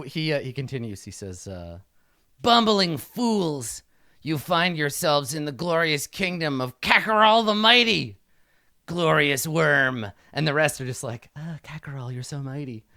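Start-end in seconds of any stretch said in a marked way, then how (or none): 2.4-10.1 grandiose kobold king. Acted. GM voices Kakarol the Mighty. 11.4-12.8 softer, sighing flattery; a hint of adoring kobold minions; fawning courtiers. Courtiers fawn over the king.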